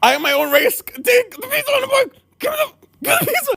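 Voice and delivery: high, rushed shouted repetition; mock frantic repeat. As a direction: rattle it off desperate for the pizza. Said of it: "repeat the following sentence".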